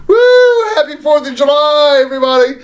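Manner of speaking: high-pitched party cheer; acted festive holler, starting very high; celebratory whoop. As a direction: burst in whooping to the whole room.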